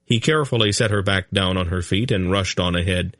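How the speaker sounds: ordinary delivery, nothing marked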